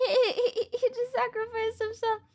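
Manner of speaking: high, laughing and tearful; laughing through tears. Real, not acted. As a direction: laugh while half crying. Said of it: "I'm so sad".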